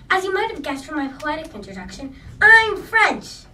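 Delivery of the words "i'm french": loud, high proud declaration; proud exclamation. Acted. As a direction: announce it proudly and loudly.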